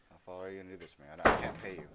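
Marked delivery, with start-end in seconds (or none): 1.2-1.9 loud I can't pay you. Acted. Gamer shouting to his follower, loud stress on pay. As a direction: pay punched much louder.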